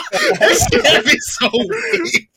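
high wheezing laughter through the words; breathless laughing fit; laughing hard. Real, not acted. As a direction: crack up helplessly while talking. Laughing hard retelling the encounter.

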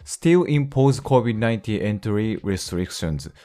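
slow, measured English reading; English read-aloud. Real, not acted. Teacher reading an English news sentence to learners, measured read-aloud. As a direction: read the news line out slowly, word by word.

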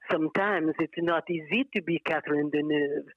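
a light French accent, imitating an actress in an ad; lightly imitated French accent, Deneuve style; Catherine Deneuve French accent. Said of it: "all I could think about was, Catherine Deneuve".

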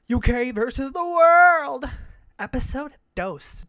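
loud, slow title call; big slow show title; announcer title. Acted. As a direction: call the show name like a host.

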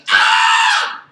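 high, loud mock scream of fright; terrified scream. Preacher acts disciples seeing ghost on water, terrified scream.

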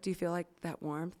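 soft hushed question, mocking a fake healer. Friend hushing her hands near mine, a soft mock-mystic question. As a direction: ask it softly like a pretend energy healer. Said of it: "A S M R".

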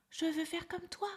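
a little elephant pleading to learn. A narrator's reading. Little elephant asking the parrot, high eager voice.

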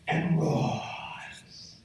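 long, whispered roar drawn out on the word; soft, airy mock roar; breathy roar on "roars". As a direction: stretch "roars" into a voiceless roar.

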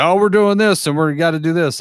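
sudden high-pitched eagerness; chipper mock go-along. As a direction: play it like a cheerful dupe. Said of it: "he's just like".

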